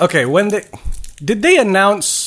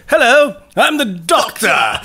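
posh English imitation; 6 semitones higher, slower than usual. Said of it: "he doesn't have to be like".

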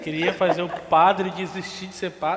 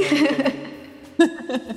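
laughing; 8 semitones higher, much slower than usual. Acted. Laughing plea about TV foot.

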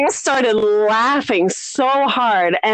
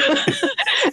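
high laugh. Real, not acted. Laughing at her story.

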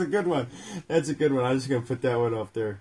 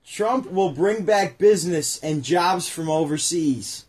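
slow read-aloud; 3 semitones higher, 5 dB louder, slower than usual. Acted. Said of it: "reading the book aloud".

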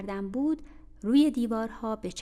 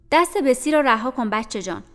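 stern scolding aunt; 3 semitones higher, 11 dB louder than usual. Acted. Mrs Reed scolds Jane.

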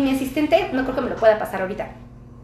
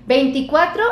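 usual speaking voice

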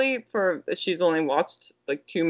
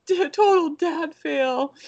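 higher, slow mock dad lament; imitating the husband; 9 semitones higher, slower than usual. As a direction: drag out the self-mocking dad. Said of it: "He was like".

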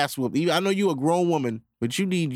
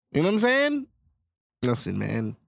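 shady insider voice. Acted. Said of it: "I put it in that tone".

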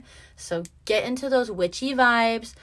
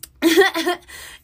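high burst of laughter; loud laugh; 9 semitones higher, 7 dB louder than usual. Real, not acted. Laughing at her own Uber story, loud burst. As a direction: crack up loudly.

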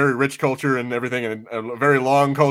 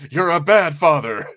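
slow, mild mock scolding old man; lecturing old man; 2 semitones higher, slower than usual. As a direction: wag a finger at a bad father, slowly. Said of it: "the old man just lecturing".

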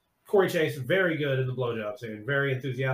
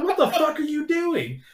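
high, put-on woman's shriek of outrage; mock shocked stepmom; 14 semitones higher than usual. Stepmom catching him with the pie, high mock shriek.

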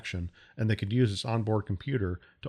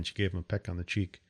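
usual speaking voice

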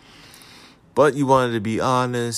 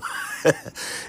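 quiet laugh; 3 dB quieter than usual. Real, not acted. Laughing at own joke.

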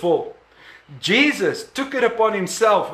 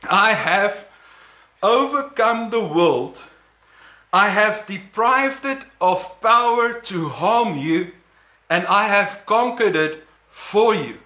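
slow deliberate rereading. Shown as a demonstration. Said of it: "I need to read this again".

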